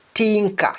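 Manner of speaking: tinker with a hard T for th; Jamaican pronunciation. Shown as a demonstration. Teacher showing learners Jamaican speech, tinker with a plain T. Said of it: "in Jamaican".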